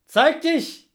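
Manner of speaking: loud shout into the woods; calling out. Real, not acted. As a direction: yell his name across the dark. Calling the late guest out.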